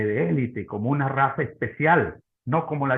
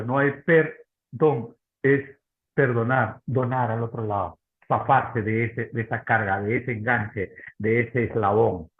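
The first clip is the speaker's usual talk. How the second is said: preaching; 3 semitones lower than usual. Acted. Preaching on forgiveness, slow.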